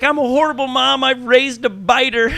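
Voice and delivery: high, loud hammed-up wail; loud, high mock lament; mock despair. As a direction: overplay her despair, half laughing. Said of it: "she took it all personal".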